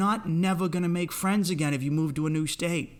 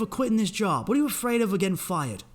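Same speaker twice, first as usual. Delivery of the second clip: normal talking voice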